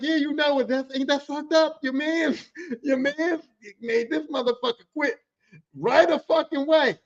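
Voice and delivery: high, gloating delivery broken by a laugh; laughing, basking in glory. Retold or imitated. Tattling on his man to the boss, laughing gloatingly. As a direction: gloat with a chuckle, pitch held high. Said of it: "And I'm laughing, basking in my glory".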